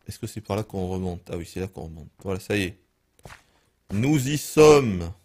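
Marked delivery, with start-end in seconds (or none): 3.9-5.1 slow, loud, solemn "we are here"; dramatic announcement. Acted. Gamer announcing arrival in hell, slow and loud.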